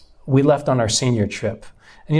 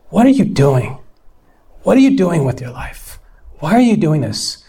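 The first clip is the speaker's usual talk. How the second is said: louder, pleading questions; God's rebuke; 3 semitones higher, 6 dB louder than usual. Retold or imitated. God rebuking a wayward son, louder. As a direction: shake him awake with each question. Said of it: "And God spoke to me".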